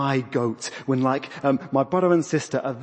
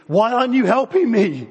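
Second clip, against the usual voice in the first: high, loud, hammed-up complaint; passive-aggressive whine; 8 semitones higher, 6 dB louder than usual. Martha to the sister who won't help, passive-aggressive mock wail. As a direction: wail it as a pointed mock grievance. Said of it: "passive-aggressive speak".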